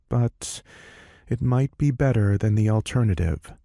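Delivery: ordinary delivery, nothing marked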